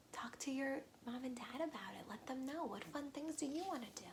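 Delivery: soft whispered aside. Real, not acted. Teacher whispering to young listeners, soft and quick. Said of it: "aside to children".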